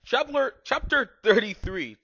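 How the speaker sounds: loud and high, with a slow grand delivery; mock announcer. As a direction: announce it like a game show host.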